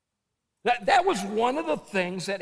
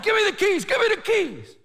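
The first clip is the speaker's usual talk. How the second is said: much higher, loud shouted demand; robber's demand; 6 dB louder than usual. Retold or imitated. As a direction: yell it like the robber with a gun. Robber screaming for the keys.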